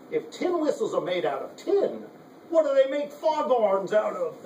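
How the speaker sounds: a hint of a Cockney comic line; Cockney accent. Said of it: "like in a Cockney accent".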